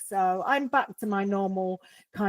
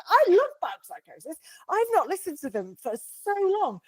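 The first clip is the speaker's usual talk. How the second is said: higher, keen outburst of fandom; retold enthusiasm; 7 semitones higher than usual. Podcaster recalling her reaction to a band, retold enthusiasm. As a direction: gush it like a remembered reaction. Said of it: "And I was like".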